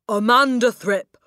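Amanda Thripp bellowed high and loud, ending in a hard fall; angry shout. Acted. Headmistress calls the girl out, yelling Amanda Thripp. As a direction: yell the name Amanda Thripp as a furious summons. Headmistress summoning pupil angrily.

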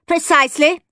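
high, pointed teacher's reply; pointed snub. A narrator's reading. Teacher curtly snubs Henry.

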